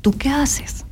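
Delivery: hushed, breathy retold question from the doctor; whispered question. Doctor quoted asking a question.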